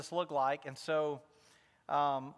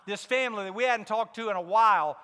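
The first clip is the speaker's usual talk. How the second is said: usual speaking voice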